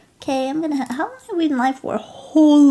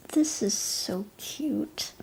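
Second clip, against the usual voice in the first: whisper; 3 semitones lower, 8 dB quieter, slower than usual. Real, not acted. Overwhelmed by cute fan art.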